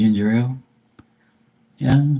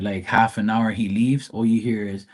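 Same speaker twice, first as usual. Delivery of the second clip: ordinary talk, nothing marked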